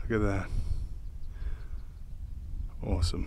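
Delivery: soft breathy whisper of awe. Real, not acted. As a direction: whisper it in quiet wonder. Awed at the view from hilltop.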